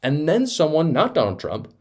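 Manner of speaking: ordinary talk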